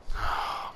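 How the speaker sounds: long unvoiced exhausted sigh; heavy sigh. Real, not acted. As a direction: breathe out all the frustration. Despair after the crash.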